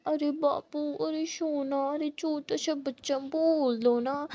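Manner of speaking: ordinary delivery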